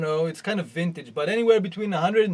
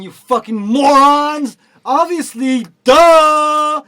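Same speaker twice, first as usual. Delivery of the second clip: louder, slowed, yelling insults; furious shout; 5 semitones higher, 11 dB louder, much slower than usual. Real, not acted. Ranting at commenters who defend the synth, furious yell. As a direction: blow up at them, spitting out each word.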